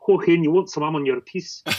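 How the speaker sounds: imitating the café lady's broad Scottish accent. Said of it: "That was pretty good".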